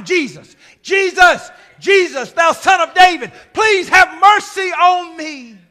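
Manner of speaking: loud, repeated pleading cries; insistent shouting. Acted. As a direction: shout for mercy, stretching the last words. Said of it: "And he keeps shouting".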